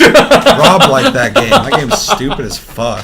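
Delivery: high squealing laugh through words. Real, not acted. Laughing while mocking a game.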